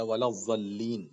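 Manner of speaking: ولا الضالین with a z-like daad, short vowel; alternative daad. Shown as a demonstration. Teacher offers other daad, short z sound in ولا الضالین. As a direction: say ولا الضالین unstretched, wa-laz-zal-leen. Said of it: "ضاد پڑھیں چاہے ضاد پڑھیں".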